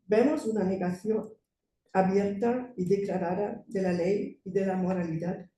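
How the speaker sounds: ordinary talk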